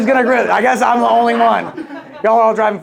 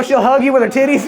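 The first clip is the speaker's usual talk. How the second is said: ordinary talk, nothing marked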